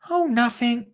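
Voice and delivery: high-pitched, offhand little reply; Dottie's child voice. Acted. Dottie keeps the secret from Lottie, high child voice. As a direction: answer in a high child character voice. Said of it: "said Dottie".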